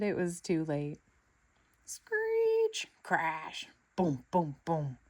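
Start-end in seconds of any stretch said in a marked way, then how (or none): 1.8-2.9 screeching tires. A narrator's reading. Sound effect in story. 3.0-5.0 crash booms. A narrator's reading. Crash sound effects read out.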